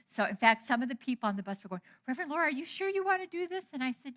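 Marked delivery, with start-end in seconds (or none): 2.1-3.7 higher, fast anxious question; worried companions. Retold or imitated. Fellow travellers doubting the minister, quick high worried question. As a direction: rush it out like nervous friends asking.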